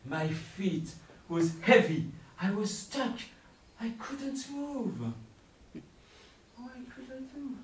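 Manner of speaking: high, rising frightened telling; panicked storytelling. A narrator's reading. Frozen with fear in story.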